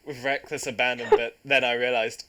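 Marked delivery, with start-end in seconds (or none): none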